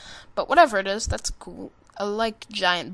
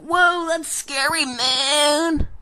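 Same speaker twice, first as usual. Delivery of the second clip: higher, louder, slow goofy voice; mock scared soldiers; 7 semitones higher, 6 dB louder, much slower than usual. Gamer mocking soldiers at the flag, slow high goofy voice. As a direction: play the soldiers as goofy and spooked. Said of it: "and they're all like".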